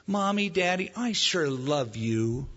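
put-on slow, sugary child buttering up parents; sweet-talking child. Child flatters parents before asking for something. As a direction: coax it out sweetly. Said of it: "And so you might say".

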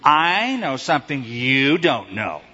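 slow teasing taunt, "I" and "you" stretched out; kid's taunt. Retold or imitated. Kid taunting others with a secret, "I" and "you" stressed. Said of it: "Remember doing that as a kid?".

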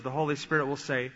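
ordinary talk

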